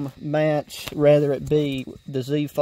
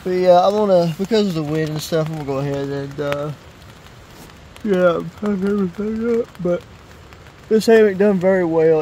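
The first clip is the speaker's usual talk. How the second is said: shouting over wind; 4 semitones higher, 6 dB louder than usual. Real, not acted. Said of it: "hear me over the wind".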